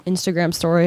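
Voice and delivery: normal talking voice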